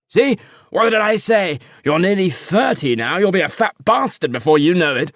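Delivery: an uncle guffawing at his own joke; jeering uncle. Acted. Uncle teasing his nephew, loud and high.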